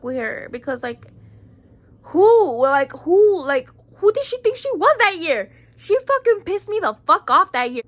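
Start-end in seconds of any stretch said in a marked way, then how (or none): 2.0-7.8 heated outrage. Real, not acted. Angry rant about the girl.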